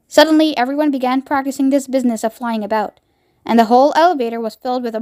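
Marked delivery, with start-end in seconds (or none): none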